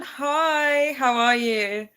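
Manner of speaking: ordinary talk, nothing marked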